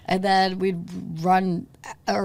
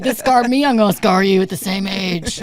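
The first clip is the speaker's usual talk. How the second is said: mock mom voice; 6 dB louder than usual. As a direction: ham up a scolding mom. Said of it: "My mom's like".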